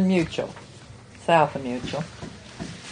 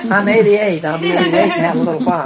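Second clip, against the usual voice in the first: laughing; 7 dB louder than usual. Real, not acted. Joking about her age.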